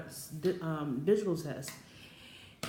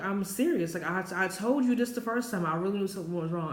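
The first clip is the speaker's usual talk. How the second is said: louder, higher retold protest; emphatic insistence; 4 semitones higher, 5 dB louder than usual. Telling doubting boyfriend she is serious, insistent. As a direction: insist forcefully, speeding up at the end.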